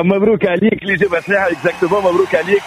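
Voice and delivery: upbeat quiz host voice; game show host. Acted. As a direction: announce the winner like a quiz host. Radio contest with a caller.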